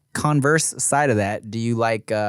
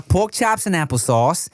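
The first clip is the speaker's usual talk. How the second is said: ordinary talk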